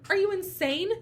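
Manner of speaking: high-pitched mock outrage; indignant retelling. Overworked employee to boss Martin, indignant. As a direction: challenge him in disbelief, pitch jumping up.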